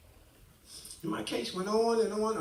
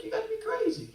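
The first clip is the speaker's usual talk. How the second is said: high, incredulous cry; you must be joking, high; disbelief; 12 semitones higher, faster than usual. Retold or imitated. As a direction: scoff at the absurd demand, pitched up. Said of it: "I'm like".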